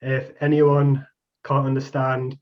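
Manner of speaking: usual speaking voice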